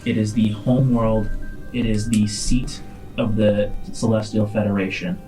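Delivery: ordinary delivery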